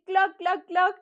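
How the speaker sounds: high, punchy hen clucks; imitación de gallina. Shown as a demonstration. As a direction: cluck like a hen in short bursts. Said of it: "La gallina hace". (cloqueo agudo de gallina)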